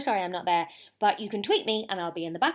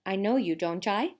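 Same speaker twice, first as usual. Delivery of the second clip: usual speaking voice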